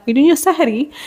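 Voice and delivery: high, lilting imitation of chatting with friends; playful quoted question. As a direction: lift the pitch well up, playfully quoting yourself. Acting out her question to friends.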